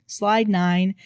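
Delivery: usual speaking voice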